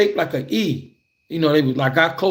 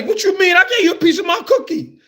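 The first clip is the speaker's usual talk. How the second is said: much higher, louder, fast outraged squeal; hammed-up indignation; indignant protest; 3 dB louder, faster than usual. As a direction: protest like a cheated kid, half laughing. Indignant protest over the cookie.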